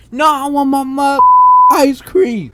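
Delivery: high, loud tantrum; mock yelling. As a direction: imitate a customer yelling a demand. Acted yelling customer demands ice cream.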